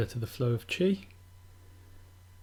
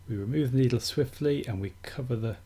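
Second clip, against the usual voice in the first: usual speaking voice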